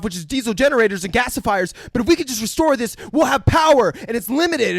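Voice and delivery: high-pitched, breathless rush of mock enthusiasm; hammed-up overeager explainer; way too excited. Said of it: "way too excited explaining the dam".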